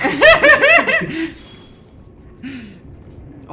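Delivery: loud, high burst of laughter. Real, not acted. Player cracking up at a crude joke, bursting laugh. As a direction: crack up and let it tail away.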